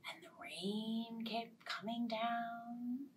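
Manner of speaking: slow whispered rain. A narrator's reading. Storyteller whispering the rain. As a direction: hush it.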